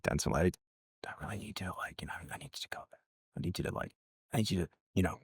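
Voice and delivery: barely audible, halting whisper; Spike Lee whispering. Retold or imitated. Director privately nudging his star between takes, whispered imitation.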